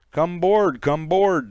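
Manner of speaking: loud, high call over the storm; captain calling out. Acted. Captain shouting to the crew over the storm, commanding. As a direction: shout the order over the wind.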